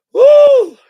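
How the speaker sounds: sudden high, loud whoop of joy; excited whoop. Real, not acted. Overcome while talking about his dad, whooping loudly. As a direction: let out a big loud cheer. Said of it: "Let me chill out".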